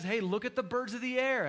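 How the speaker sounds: loud, high call to look. Retold or imitated. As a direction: call it out big to the crowd. Jesus quoted, called out.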